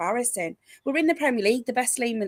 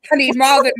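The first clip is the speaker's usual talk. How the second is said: loud, higher wail with a cry in it; anguished wail; 4 semitones higher, 10 dB louder than usual. Real, not acted. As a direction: cry out in mock despair over the magpie. Fan despairing over bad luck.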